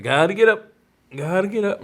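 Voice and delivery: put-on high-pitched urgent kid voice; mock-urgent, repetitive plea; imitated insistent son. Retelling sedated son's urgent insistence.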